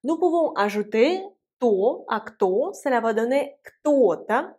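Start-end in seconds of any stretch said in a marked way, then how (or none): none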